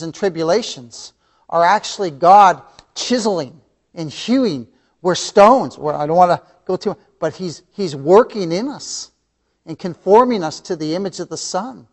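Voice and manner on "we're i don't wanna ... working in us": ordinary delivery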